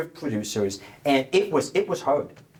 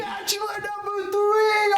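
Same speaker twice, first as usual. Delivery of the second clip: high, slow squeal of delight; excited shriek; slower than usual. Acted. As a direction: squeal the name out with joy. Host repeats with huge excitement.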